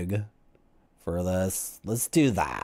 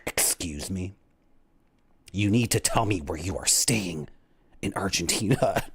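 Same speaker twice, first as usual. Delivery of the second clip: stern immigration officer; 5 semitones lower, 4 dB quieter than usual. Retold or imitated. An Argentine immigration officer questions a careless tourist, lower and stern. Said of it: "And the guy is like".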